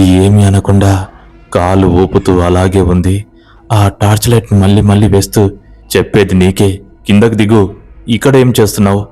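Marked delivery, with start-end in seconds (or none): none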